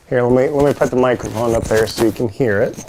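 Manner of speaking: muffled, distorted mic sound; mic too close and garbled; garbled audio. Real, not acted. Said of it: "Sorry for the garbled sound".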